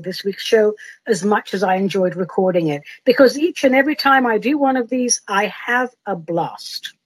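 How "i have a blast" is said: normal talking voice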